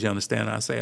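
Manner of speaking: ordinary talk, nothing marked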